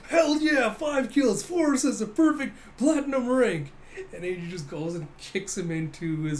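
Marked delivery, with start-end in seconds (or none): 0.1-3.7 high, loud victory cheer; triumphant gamer. Acted. Gamer captain celebrating his platinum rank, loud triumph. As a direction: crow over a perfect game result.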